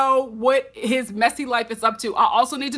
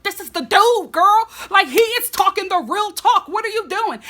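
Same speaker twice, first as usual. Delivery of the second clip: higher, louder lecture to Rachel; sassy scolding friend; 8 semitones higher, 5 dB louder than usual. Retold or imitated. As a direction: scold her like an exasperated best friend. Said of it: "And I'm like".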